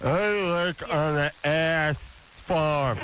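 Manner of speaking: slow, slurred through a held tongue; holding tongue with fingers. Shown as a demonstration. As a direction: talk with your tongue pinched. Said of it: "hold your tongue and say".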